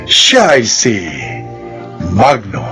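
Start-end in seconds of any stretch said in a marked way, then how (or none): none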